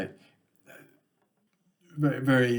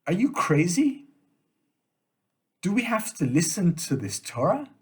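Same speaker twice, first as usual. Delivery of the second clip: higher-pitched, protesting questions; incredulous questioning; 6 semitones higher, 3 dB louder than usual. Acted. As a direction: push back in disbelief, voice swinging up. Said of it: "adds a question mark at the end".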